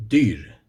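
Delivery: dyr said the Swedish way, short and rolled. Shown as a demonstration. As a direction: say dyr as a Swede would. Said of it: "in Swedish".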